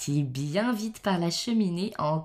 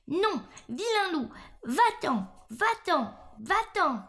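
frightened little pig's cry; 10 semitones higher than usual. A narrator's reading. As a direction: cry out high, like a scared piglet. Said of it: "s'écrièrent les trois frères, effrayés". (petit cochon effrayé, voix aiguë)

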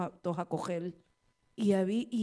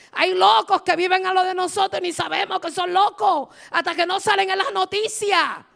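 much louder and higher, fervent pulpit voice; preaching at full force; 8 semitones higher, 11 dB louder than usual. Acted. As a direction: fire up the congregation with the lesson. Preaching climax to congregation. (predicando con fervor, a todo volumen)

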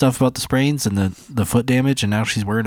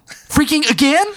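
exasperated disbelief; 14 semitones higher than usual. Retold or imitated. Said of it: "at the time I was like".

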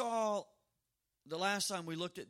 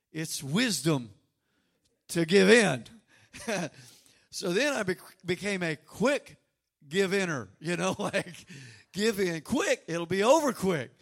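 laughing; 10 dB louder than usual. Real, not acted. Laughing about quick surrender.

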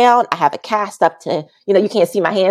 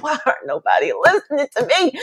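exasperated laugh; 7 semitones higher than usual. Retold or imitated. Exasperated, nobody listens.

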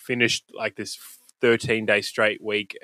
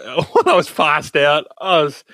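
laughing; 4 semitones higher, 4 dB louder, slower than usual. Real, not acted. Laughs telling his own beating.

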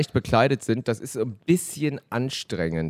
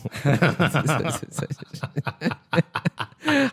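long rising burst of laughter; laughing; 5 semitones higher than usual. Real, not acted. Phone ringing, bursts out laughing.

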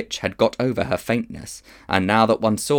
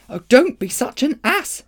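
exasperated; 5 dB louder than usual. Acted. Polly exasperated.

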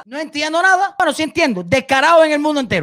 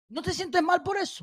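a mocking singsong, much softer; singsong mockery; 4 semitones higher, 12 dB quieter than usual. Real, not acted. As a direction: sing it at her like a nursery taunt. Taunting the pro-government woman.

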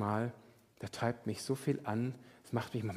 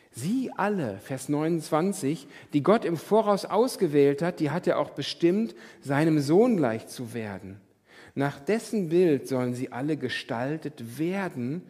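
scripture reading; 3 semitones higher, 7 dB louder than usual. Acted. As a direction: read it like Scripture, weighing each line. Reads scripture forcefully. (feierliche Bibellesung)

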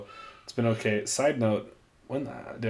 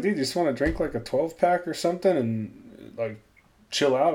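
higher-pitched, lightly acted pitch to a pal; eager retold invite; 5 semitones higher than usual. Retelling an invite to his visiting cousin, lightly eager. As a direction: replay a casual, eager suggestion.

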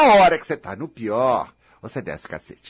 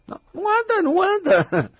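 high-pitched mimicked complaint; exaggerated student's lament; mock whining; 12 semitones higher than usual. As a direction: whine it up high, making fun of the complainer.

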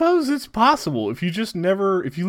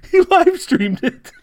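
words squeezed out through laughter; cracking up mid-sentence; laughing; 13 semitones higher, 8 dB louder, slower than usual. Real, not acted. Laughing at a wild comment.

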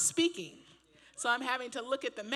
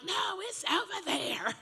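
high insistent retort; 9 semitones higher, slower than usual. Retold or imitated. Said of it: "And I'm like".

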